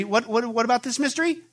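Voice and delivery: ordinary talk, nothing marked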